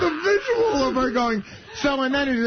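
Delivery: high-pitched, laughing through the words. Real, not acted. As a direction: tell it while cracking up. Laughing while telling the story.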